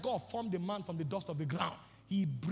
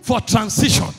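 loud, high, driving sermon voice; preaching at full force; 5 semitones higher, 19 dB louder than usual. Acted. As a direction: hammer each line at the crowd.

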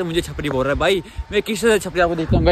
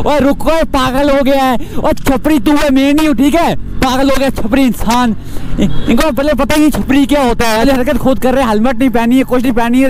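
high, loud yelling from the moving bike; angry shouting; 6 semitones higher, 6 dB louder than usual. Real, not acted. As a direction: shout the rider down, calling him crazy. Shouting at the riders again. (चलती bike से ऊँची आवाज़ में गुस्से से चिल्लाना)

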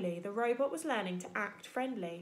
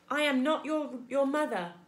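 a lightly louder, even robot voice; 4 semitones higher, 5 dB louder than usual. Acted. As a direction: speak like a calm, patient robot. Robot character voice.

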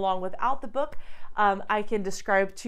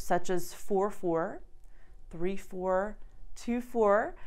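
ordinary delivery

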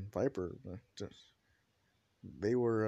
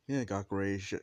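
ordinary delivery

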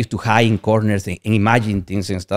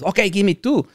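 moved buyer relenting; 9 semitones higher than usual. Retold or imitated. Acted reply of the moved man.

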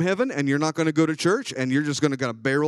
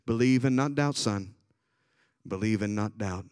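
lower, softer and gentle; tender reassurance to a son; Jesus gently sending the father back; kind, gracious Jesus; 6 semitones lower, 4 dB quieter than usual. Retold or imitated. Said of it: "Jesus kindly and graciously said".

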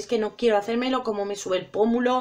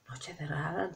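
"noche cerrada" dropped to a much softer, lower hush; hushed dramatic; 9 semitones lower, 16 dB quieter than usual. Shown as a demonstration. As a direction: sink "noche cerrada" down to a soft murmur. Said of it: "Para hacerlo más dramático".

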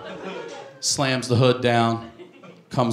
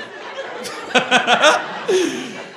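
high wheezing laugh; helpless, squeaky laughter; breathless laughing; 13 semitones higher than usual. Acted. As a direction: laugh till you cannot breathe. Acts boys unable to breathe laughing.

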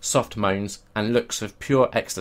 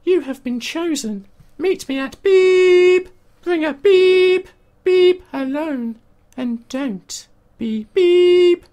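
very high, slow, far-off sultry voice; sultry perfume ad; 8 dB louder, much slower than usual. Acted. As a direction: purr it like a perfume ad. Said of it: "A seductive, sultry voice read".

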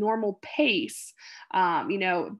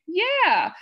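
patient's stock yeah; 9 semitones higher, 6 dB louder than usual. Retold or imitated. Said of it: "the only word she could say is".

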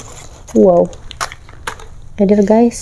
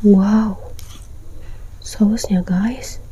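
whispering; 6 dB quieter, much slower than usual. Real, not acted. Showing the sauce on camera.

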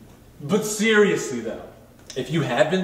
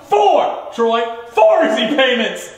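home shopping host; 5 semitones higher, 6 dB louder, slower than usual. Acted. Correcting the co-host.

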